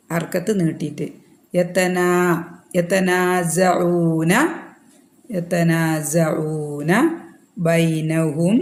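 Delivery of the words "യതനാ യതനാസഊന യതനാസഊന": യതനാസഊന with the nūn vowel stretched two counts, very slow. Shown as a demonstration. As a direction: draw out the നാ in യതനാസഊന. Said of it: "അര അലിഫ് സഗീറുണ്ട്". (യതനാസഊന എന്നതിൽ നൂൻ രണ്ട് ഹർക്കത്ത് നീട്ടുക)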